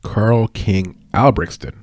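Albrechtsson said slowly, each syllable apart; slow, deliberate pronunciation of Albrechtsson. Shown as a demonstration. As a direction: carefully sound out the name Albrechtsson. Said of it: "I always have to say it slowly".

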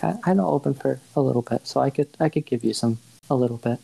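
lightly voiced reluctant shopkeeper; hesitant shopkeeper Ash.

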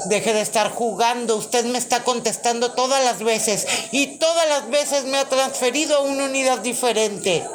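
loud, high, fed-up shouting; exasperated caller. Acted. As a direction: yell at a useless operator. Skit, furious caller.

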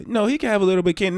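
high, fast indulgent grandma voice; mock grandma. Grandma overruling dad about candy, high and quick. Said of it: "grandmother arguing".

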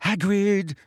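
high, frightened plea; thin terrified girl's voice; high-pitched, terrified. A narrator's reading. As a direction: call out to him in fear. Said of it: "in a high-pitched and terrified voice".

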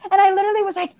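ordinary talk, nothing marked

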